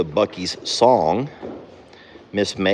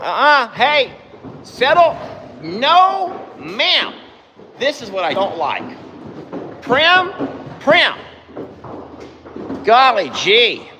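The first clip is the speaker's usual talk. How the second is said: high yelling at the horses; 13 semitones higher, slower than usual. Real, not acted. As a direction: break up the fight by yelling. Horses fighting, owner yelling.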